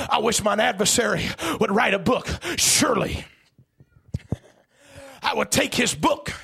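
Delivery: loud, driving, chanted sermon shout; full-force preaching. Acted. Preacher daring the devil to write a book, full-force preaching. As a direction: hammer each repeated wish at full cry.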